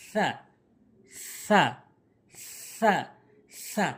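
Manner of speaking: সা as a soft th, tongue tip on the teeth edges; soft th sound. Shown as a demonstration. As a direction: say each সা lightly, lisped. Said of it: "নরম করে".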